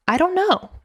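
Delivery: high-pitched, helpless admission; not recommended answer. Shown as a demonstration. Coach shows clients what not to say, high and clueless. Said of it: "here's what I don't recommend saying".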